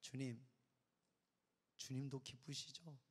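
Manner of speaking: soft, slow tender prayer to God. Retold or imitated. Pastor recalls praying to God, tender and soft. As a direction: ask God softly, tenderly. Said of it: "딱 한마디 했어".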